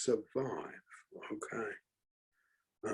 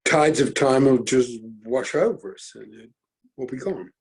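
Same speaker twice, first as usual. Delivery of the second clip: ordinary talk, nothing marked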